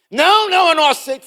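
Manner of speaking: hammed-up furious church lady; indignant sister. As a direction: protest with outrage. Angry sister refusing.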